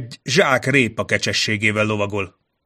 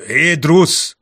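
raised, higher-pitched shout; calling out; 7 semitones higher, 7 dB louder than usual. A narrator's reading. Envoy hailing Drusz from below the walls, calling out. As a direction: call up to someone on the walls. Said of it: "Kiabált fel Mazson".

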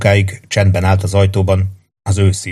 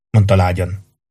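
ordinary delivery, nothing marked

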